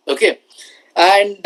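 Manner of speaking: normal talking voice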